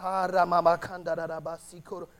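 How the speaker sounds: rhythmic syllables of tongues; praying in tongues. Shown as a demonstration. Preacher demonstrating praying in the Spirit, flowing syllables. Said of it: "and you just".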